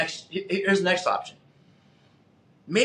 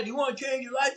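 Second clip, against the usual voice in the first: high, shouted call to the crowd; hyped-up speaker shout; 9 semitones higher, 4 dB quieter, faster than usual. Retold or imitated. Seminar speaker asking the crowd again, high shout. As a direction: keep pumping the room.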